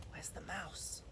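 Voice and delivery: hushed storyteller's whisper; breathless whispered aside. Acted. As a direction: whisper it like a secret to the kids. Narrator whispers aside to listener.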